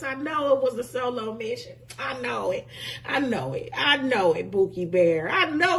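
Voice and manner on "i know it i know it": ordinary talk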